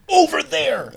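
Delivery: high, shouted cop command; imitated cop shouting; yelled directions. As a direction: yell it like an exasperated cop. Cop yelling directions at driver.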